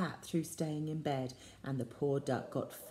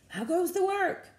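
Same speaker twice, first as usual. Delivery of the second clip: high, loud farmer's call; inviting children to join the farmer; 12 semitones higher, 5 dB louder than usual. A narrator's reading. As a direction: say it like the lazy farmer.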